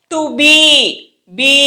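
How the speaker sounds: BE with a firm B and stretched vowel; teacher modelling a long బీ; long emphatic BE. Shown as a demonstration. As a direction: hold the ee in BE long. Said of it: "చూసారా ఎంత లాంగ్గా పలుకుతున్నామో".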